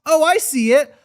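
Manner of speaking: hammed-up schoolkid excitement; excited kids. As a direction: chime in like kids getting carried away. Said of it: "the other kids were like".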